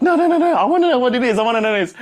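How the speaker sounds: high, loud laughing plea; hammed-up begging squeal; mock begging. Kaylee begging [name] to tell her, loud laughing squeal. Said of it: "she started begging me".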